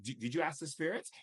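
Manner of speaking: ordinary talk, nothing marked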